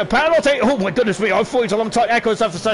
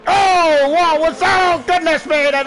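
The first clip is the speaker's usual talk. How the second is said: higher, louder, slowed astonishment; startled; 6 semitones higher, 4 dB louder, slower than usual. Real, not acted. Commentator startled by a sudden incident, astonished. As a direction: react as if you can't believe your eyes. Something sudden happens on track.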